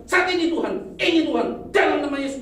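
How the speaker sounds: high, loud mock proclamation; loud mocking voice of a boastful believer; mocking quote. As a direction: ham up a self-important claim to be God. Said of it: "Terus kenapa ngomong".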